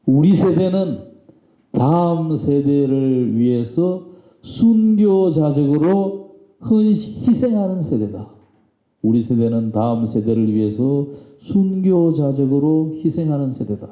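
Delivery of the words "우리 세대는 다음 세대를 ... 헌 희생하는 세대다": slow, higher-pitched oratorical declaration; stretched-out, raised-voice rallying quote; proclaimed. Retold or imitated. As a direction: proclaim it like a leader addressing a crowd. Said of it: "그렇게 외친 거예요".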